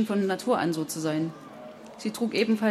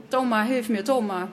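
raised, higher plea; boy crying for help; 4 semitones higher than usual. A narrator's reading. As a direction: call out urgently to be rescued.

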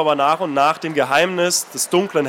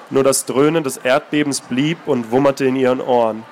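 calm, measured reading of a novel; story narration; 3 semitones lower than usual. A narrator's reading. Author reading his novel aloud, steady narration. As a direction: read the passage steadily, letting it speak.

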